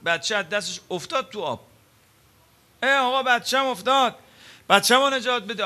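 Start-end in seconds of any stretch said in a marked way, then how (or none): 2.8-5.6 high, loud panicked plea for help; desperate mother's cry. Acted. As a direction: cry out like a mother watching her baby drown. Mother's plea as child falls.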